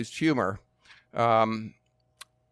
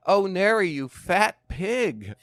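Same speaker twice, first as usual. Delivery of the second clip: mock insult; 7 semitones higher, 4 dB louder, slower than usual. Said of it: "I could very easily say".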